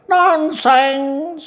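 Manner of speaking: very high, drawn-out indignation; irritated cry. A narrator's reading. Cuckoo-doctor offended by her answer, irritated cry. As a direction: squawk it slowly in falsetto annoyance. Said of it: "doctor कोकिळ एकदम चिडून ओरडले".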